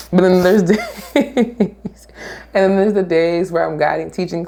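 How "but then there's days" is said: laughing. Real, not acted. Laugh mid-line.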